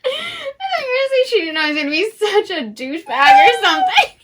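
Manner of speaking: laughing through the words; laughing at her own guess about the grumpy hero. Real, not acted. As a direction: giggle it out. Laughing while guessing plot.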